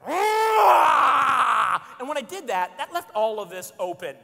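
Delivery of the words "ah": loud high mock growl shout; louder playful roar. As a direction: roar to get the toddler running. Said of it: "growl a little louder".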